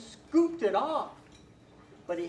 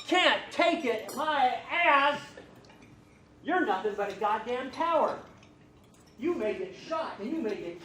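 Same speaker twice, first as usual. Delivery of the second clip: furious, louder scornful shout; angry outburst; 4 semitones higher, 5 dB louder than usual. Acted. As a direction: tear into him with scorn. General berates crying soldier.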